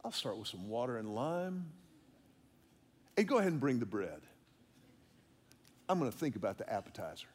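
low, unhurried fine-dining voice; leisurely diner. Retold or imitated. Diner at Ruth's Chris ordering, relaxed and low. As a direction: savour the order like a long night out. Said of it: "you're like".

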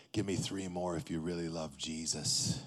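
low, quiet trainer growl of a dare; gruff trainer. Retold or imitated. Trainer pushing him during dips.

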